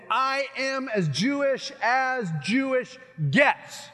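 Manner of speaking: loud, slow, emphatic declaration; Paul's emphatic boast. Acted. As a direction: proclaim it proudly, stretching each word. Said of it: "Paul is saying".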